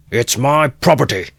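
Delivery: high, loud defiant yell; angry shout. A narrator's reading. Mikolka defending his right to beat her, angry shout. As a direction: hurl it at the crowd. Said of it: "shouted Mikolka".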